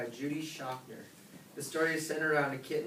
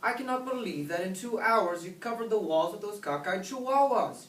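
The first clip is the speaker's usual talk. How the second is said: higher-pitched motherly scolding; 6 semitones higher, 3 dB louder than usual. A narrator's reading. Mama tells off kitten over drawn-on walls, higher-pitched scolding. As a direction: tell off the child in exasperation. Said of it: "she scolded".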